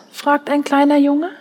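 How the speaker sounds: ordinary delivery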